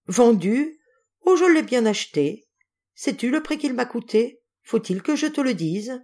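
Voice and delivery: worked-up, ominous rhetorical questions; agitated retort. A narrator's reading. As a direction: throw the word back, then taunt. Husband retorts about the violin's price.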